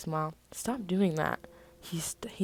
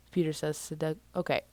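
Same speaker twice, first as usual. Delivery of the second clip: usual speaking voice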